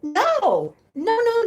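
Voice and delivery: high, loud no no no; mock panicked refusal; frantic refusal. The driver refusing the man's lewd request, loud high no no no. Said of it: "she's like".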